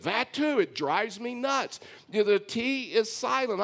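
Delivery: high, emphatic mock complaint; retold exasperation. Preacher voices his wife objecting to soften, exasperated. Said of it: "She said".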